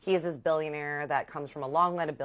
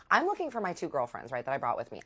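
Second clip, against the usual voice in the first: usual speaking voice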